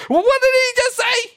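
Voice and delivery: a scandalised Pharisee shrieking in disbelief; outraged Pharisee. Retold or imitated. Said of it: "Pharisees go nuts".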